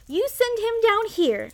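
put-on high-pitched, cocky child voice; proud little boy. A narrator's reading. Young duke calling up to the king, proud boy's voice. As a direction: pipe up like a bossy little boy. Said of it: "a very proud little boy".

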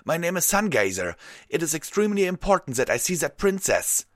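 high, loud, urgent plea; crying out. A narrator's reading. Unicorn held back by guards, high urgent cry. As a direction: push the words out high and fast. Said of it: "the unicorn cried out".

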